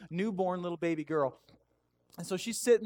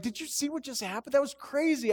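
high-pitched retold amazement; excited aside; 8 semitones higher than usual. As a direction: replay an eager, astonished aside. Acts excited aside to wife.

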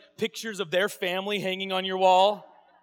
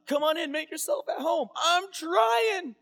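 high, loud, eager yell of acceptance; Jesus eagerly accepting the invitation; 9 semitones higher, 3 dB louder, slower than usual. Retold or imitated. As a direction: burst in ready to move in.

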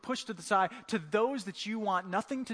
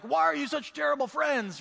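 higher, louder scolding outburst; mock angry; 6 semitones higher, 5 dB louder than usual. Jesus scolding his disciples, mock angry. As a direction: lay into them, half-joking. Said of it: "get mad and lay into these guys".

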